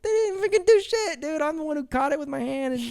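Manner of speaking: high-pitched mock complaint; indignant high retort. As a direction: squeal your protest. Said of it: "I'm like".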